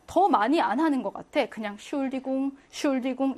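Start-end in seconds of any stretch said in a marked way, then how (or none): 1.8-3.4 "슐디궁" as natives say it, with no ent; casual "슐디궁", starting straight on schul; dropped prefix. Shown as a demonstration. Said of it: "엔트를 발음하지 않기도 합니다".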